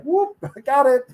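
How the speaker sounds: high pitched whoop with a laugh; breezy, surprised catch; imitating an offhand hero; casual catch. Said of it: "he's just like".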